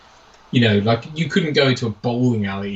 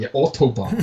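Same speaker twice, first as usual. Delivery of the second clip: usual speaking voice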